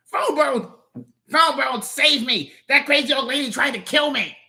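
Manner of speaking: high, frantic cry for rescue; panicked plea. Acted. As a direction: beg your cousin to save you. Trapped cousin begs for rescue.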